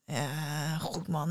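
low, subdued groan of refusal. A narrator's reading. Hanged man balks at the house ringed with fire, groaning. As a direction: groan it softly, putting him off. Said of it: "De man kreunde".

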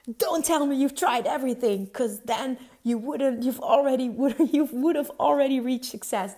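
Tony Robbins shouting. Retold or imitated. Quoting a seminar speaker to her viewers, a loud imitated yell. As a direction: drive the point home to the crowd. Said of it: "Dat is wat hij schreeuwde toen".

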